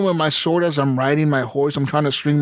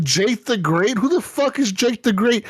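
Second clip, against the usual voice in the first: incredulous, rising; 7 semitones higher than usual. Retold or imitated. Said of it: "I'm like".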